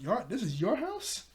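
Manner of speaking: high, rising astonished question; retold surprise climbing high; incredulous. Retold shock at whose house.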